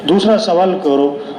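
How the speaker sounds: ordinary talk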